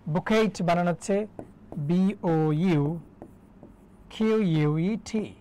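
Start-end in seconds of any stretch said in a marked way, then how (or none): 1.8-5.3 very slow, drawn-out letters; spelling out. Shown as a demonstration. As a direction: dictate each letter separately and stretched. Teacher spells bouquet letter by letter. (টেনে টেনে ধীরে অক্ষর ধরে বানান)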